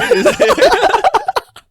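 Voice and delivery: high squealing laugh; high burst of laughter. Real, not acted. Laughing with his friend at paying for it, high squeal.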